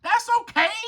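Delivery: high little cub voice; accepting lion cub. Acted. Lion cub after a failed hunt, high accepting voice. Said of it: "They're saying".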